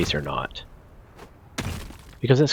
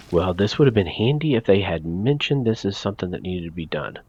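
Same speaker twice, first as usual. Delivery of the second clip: usual speaking voice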